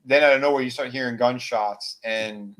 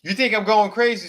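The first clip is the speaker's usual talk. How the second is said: higher, louder accusing question; paranoid question; 9 semitones higher, 6 dB louder than usual. Retold or imitated. Deputy accusing David and Judy, higher paranoid voice. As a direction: turn on them, suspicious.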